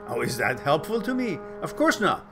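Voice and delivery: ordinary talk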